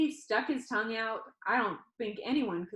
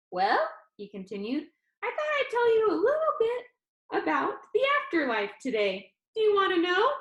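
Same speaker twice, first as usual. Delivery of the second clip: put-on upbeat young guy, higher pitched; perky showman pitching to his audience; cheerful Danny; 9 semitones higher, 4 dB louder than usual. Danny on video teasing the funeral crowd, bright and upbeat.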